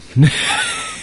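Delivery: high, strangled monster screech; monster roar. Retold or imitated. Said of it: "That's my monster impression".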